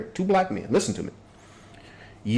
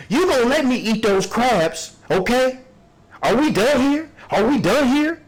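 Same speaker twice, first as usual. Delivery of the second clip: higher, whiny mock plaintiff; imitating the plaintiff; 6 semitones higher than usual. Mocking the upset plaintiff in a higher voice. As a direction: play the upset plaintiff, pitched well up. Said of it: "she was upset".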